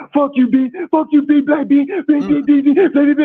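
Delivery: high falsetto cursing, dissolving into repeated syllables; mock cursing match. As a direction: mimic a heated tirade in a high voice. Mimics the cursing in the argument.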